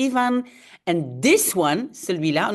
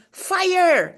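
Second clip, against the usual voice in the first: mock shout; 9 semitones higher than usual. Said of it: "is shouting".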